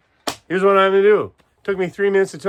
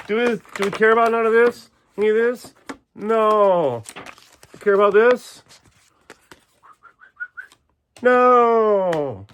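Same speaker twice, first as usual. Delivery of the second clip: louder, higher rhetorical questions; hyped up; 6 semitones higher, 3 dB louder than usual. Real, not acted. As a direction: pump up the crowd with questions.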